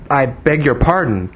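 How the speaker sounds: usual speaking voice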